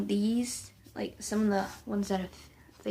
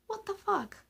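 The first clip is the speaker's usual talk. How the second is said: incredulous; 11 semitones higher, 5 dB quieter than usual. Retold or imitated. Inner reaction to teacher, retold.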